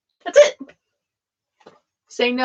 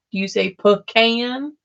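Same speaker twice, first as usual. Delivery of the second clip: normal talking voice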